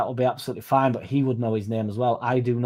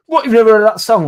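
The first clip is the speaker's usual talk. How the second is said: high, loud imitation of disbelief; mock incredulity; 10 semitones higher, 10 dB louder than usual. Punter to the DJ who hasn't heard the song, mock incredulous. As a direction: pitch it way up as an outraged punter.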